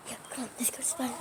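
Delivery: fast sneaky whisper. Real, not acted. Sneaking off to spy.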